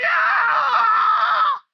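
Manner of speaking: long, high scream; high wailing cry of terror; death scream. Acted. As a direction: scream as you are killed. Follower is killed.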